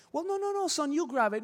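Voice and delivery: high-pitched mock protest from a dad; dad's voice. Dad telling his son to take the candy himself, imitated high protest. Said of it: "the dad's like".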